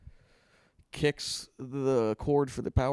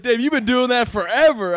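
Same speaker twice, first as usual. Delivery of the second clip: put-on amused old friend; 10 semitones higher, 11 dB louder than usual. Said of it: "he was like".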